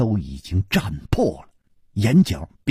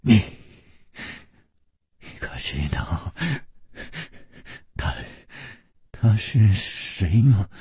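bitter smile; 3 semitones lower, 4 dB quieter than usual. A narrator's reading. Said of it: "露出了一丝惨笑".

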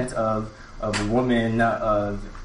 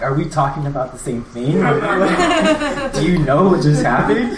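high, loud, laughing through the question; bursting into giggles while asking; retold question cracking up with laughter; laughing disbelief; 5 semitones higher, 6 dB louder than usual. Retelling baffled question to friend, laughing.

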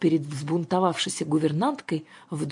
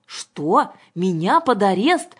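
slow, raised incredulous question; indignant; 4 semitones higher, 6 dB louder, slower than usual. A narrator's reading. Governess learns she is under arrest, indignant. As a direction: draw it out in offended disbelief. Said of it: "возмутилась Александра".

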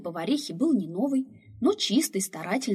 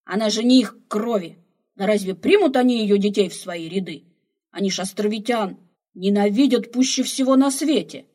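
put-on loud, heated old man arguing; loud outraged protest; heated argument; 2 semitones higher, 10 dB louder than usual. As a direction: drive the point home, loudly.

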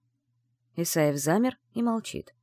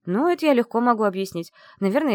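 ordinary delivery

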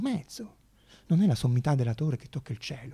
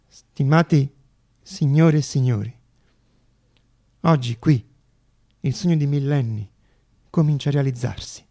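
louder, measured address to the hall; formal speech; 5 dB louder than usual. Acted. Zamenhof addressing the congress, louder formal speech. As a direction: open the congress with ceremony.

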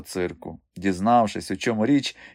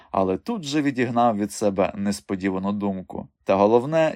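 plain reading voice

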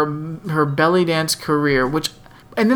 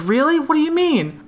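surprised; 11 semitones higher than usual. Retold or imitated. Heather surprised in retold scene.

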